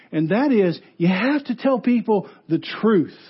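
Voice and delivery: ordinary talk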